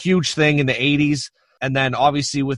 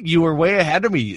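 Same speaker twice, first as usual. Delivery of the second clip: ordinary talk, nothing marked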